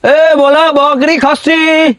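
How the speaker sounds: high, slow, drawn-out street vendor cry; hawker's call. Acted. Goat buyer hawking door to door, long drawn-out sing-song call. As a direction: stretch every word like a village goat buyer calling out.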